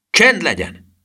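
commanding shout. A narrator's reading. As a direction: call out sharply to hush a crowd. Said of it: "kiáltotta a professzor".

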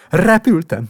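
shouted with joy; a boy crying out in triumph. A narrator's reading. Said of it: "kiáltotta". (kiáltás)

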